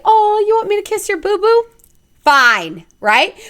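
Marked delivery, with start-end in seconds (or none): 0.0-1.7 put-on baby talk. Parent babying a child.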